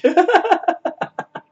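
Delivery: sudden high-pitched laugh; a squeal of real laughter; high burst of laughter. Real, not acted. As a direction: crack up high and loud. Laughing at the joke.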